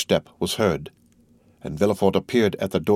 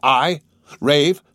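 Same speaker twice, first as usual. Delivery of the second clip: louder, higher, stung disbelief; indignant outburst; 7 semitones higher, 5 dB louder than usual. Acted. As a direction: throw the word back in outrage. Morel flung back at Villefort.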